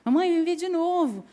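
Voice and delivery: put-on high, eager little boy begging mom; child's voice. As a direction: plead like a small child asking to watch again. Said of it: "ali ele fica". (voz de criança pedindo à mãe)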